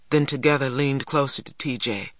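plain reading voice

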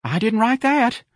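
high, slow, frightened squeak; quivering denial. A narrator's reading. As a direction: protest timidly in a piping voice. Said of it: "His lips were quivering".

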